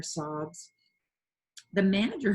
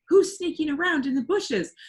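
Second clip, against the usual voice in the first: high, louder, fearful stage cry; frightened; 10 semitones higher, 7 dB louder than usual. A narrator's reading. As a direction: ask it trembling with fright. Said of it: "said in a frightened voice".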